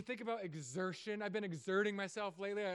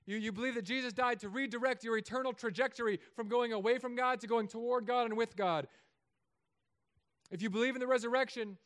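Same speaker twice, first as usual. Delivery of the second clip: louder, driving preacher's build; preaching at full force; 5 semitones higher, 7 dB louder than usual. Acted. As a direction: build the promise line by line to the crowd.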